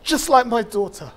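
measured poetry recital with long pauses; spoken-word performance, rising and falling with grief; spoken-word poem. Acted. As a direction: perform it like a slam poem, weighing each line.

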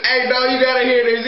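usual speaking voice

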